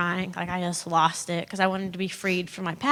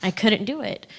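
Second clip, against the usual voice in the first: ordinary talk, nothing marked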